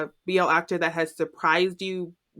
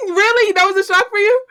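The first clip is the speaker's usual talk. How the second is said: high, loud, laughing incredulous question; amused disbelief; 11 semitones higher, 5 dB louder, faster than usual. Real, not acted. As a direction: question it in real surprise, laughing. Listener amazed at the answer.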